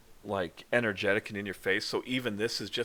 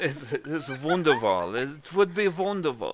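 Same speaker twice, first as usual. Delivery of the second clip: hammy German accent, amused; demonstrated German accent; 6 semitones higher, 6 dB louder than usual. Shown as a demonstration. Game master showing player the German wonderful, exaggerated accent. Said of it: "My, my German accent sucks too".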